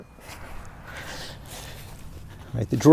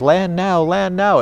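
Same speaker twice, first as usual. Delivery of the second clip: higher-pitched mock warning, repeated; imitated frantic alarm; 6 semitones higher, slower than usual. Drone warning the pilot to land, lightly frantic imitation. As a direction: mimic an alarm going nuts, pitch raised. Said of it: "normally it just goes nuts".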